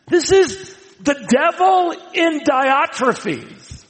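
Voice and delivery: dramatic reveal. Acted. Preacher reveals the devil.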